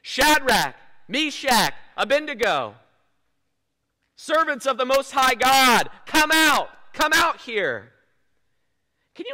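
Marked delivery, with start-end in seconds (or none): 0.0-7.9 loud, higher calling out of the furnace; a king shouting into a blazing furnace; shouted summons. A narrator's reading. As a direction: call them out of the fire at full voice. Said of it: "and shouted".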